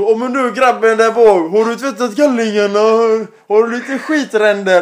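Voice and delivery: slow, loud driver's mic call; mock bus announcement. Bus-driving dad teasing his son over the mic. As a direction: drawl it out like an announcement. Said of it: "Och då".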